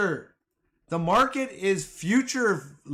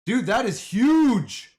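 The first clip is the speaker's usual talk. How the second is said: louder, slow emphatic awe; excited; 5 semitones higher, 5 dB louder, slower than usual. Real, not acted. To chat about the billion-dollar news, excited. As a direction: savor each word. Reacting to big market news.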